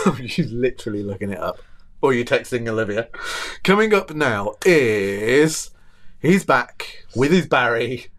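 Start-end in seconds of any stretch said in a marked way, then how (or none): 3.6-8.1 slow show-host announcement; announcer. Acted. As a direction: announce it slowly like a presenter. Announcing next segment.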